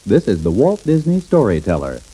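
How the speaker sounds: ordinary delivery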